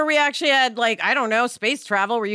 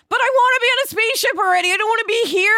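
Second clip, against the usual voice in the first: put-on high, fast, loud childish whine; whiny little kid; 9 semitones higher, 3 dB louder, faster than usual. Retelling his childhood, high childish whine.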